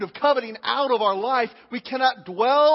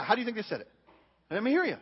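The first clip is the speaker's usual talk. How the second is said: ordinary talk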